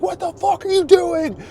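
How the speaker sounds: high, loud furious mock yell; gamer rage yell. Raging at his friends back in the day, yelling. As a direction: scream it at a bad teammate. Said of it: "old school gamer rage".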